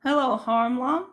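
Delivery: ordinary talk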